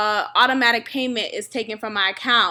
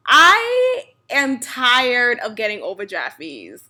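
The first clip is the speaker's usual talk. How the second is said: normal talking voice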